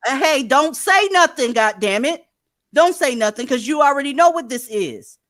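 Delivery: loud, high angry yell. Real, not acted. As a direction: shout him down, furious. Yelling at guest to shut up.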